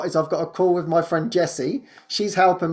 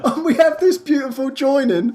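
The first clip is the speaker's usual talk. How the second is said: much higher, giggling through the words; laughing delight; 13 semitones higher, 4 dB louder than usual. Real, not acted. Telling how friend Jessie loves the spreadsheet work, laughing with delight. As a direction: bubble over with happy laughter.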